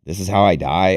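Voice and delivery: retold exasperated cry of disbelief; exasperated outburst. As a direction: blurt it out, fed up. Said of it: "but I was like".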